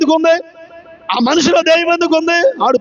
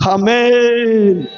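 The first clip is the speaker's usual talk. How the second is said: ordinary delivery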